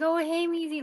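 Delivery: imitating a high, gushy pet-owner voice; gushing dog greeting. Partner fussing over his dog, mimicked gushing. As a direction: coo at the dog in mock adoration, high-pitched.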